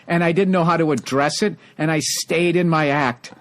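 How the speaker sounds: usual speaking voice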